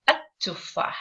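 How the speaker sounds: at-tufah with a long held T; at-tufah, T held long and the L dropped; doubled T. Shown as a demonstration. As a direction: lean on the doubled T of at-tufah, no L sound. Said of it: "I am stressing on the letter T".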